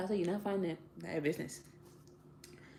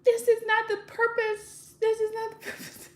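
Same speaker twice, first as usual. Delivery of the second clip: hammed-up tearful plea to the judge, rising higher, breaking into a fake cry; mock pained plea; 9 semitones higher, 4 dB louder, slower than usual. Pleading to the judge over relocation, hammed-up fake crying. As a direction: overact the hurt of losing the land. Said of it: "You see how bad my acting is?".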